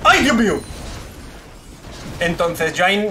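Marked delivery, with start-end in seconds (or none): 0.0-0.6 startled exclamation. Real, not acted. Sudden dismay in game.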